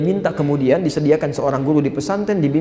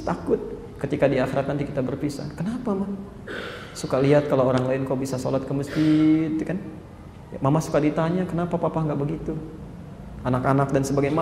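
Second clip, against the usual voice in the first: gentle wife's plea; 2 semitones lower, 8 dB quieter than usual. Shown as a demonstration. Preacher models a wife gently begging her husband to pray, soft. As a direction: softly ask your husband for help. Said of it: "masuk dengan kelembutan".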